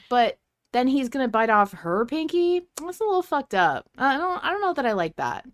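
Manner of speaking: rising outrage at the pinky plan; indignant. Real, not acted. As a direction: pitch up, indignant disbelief. Indignant about biting pinky.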